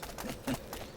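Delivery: breathy little laugh between words; soft gleeful laugh; gleeful chuckle. Acted. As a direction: chuckle with relish. Arms dealer lists weapons gleefully.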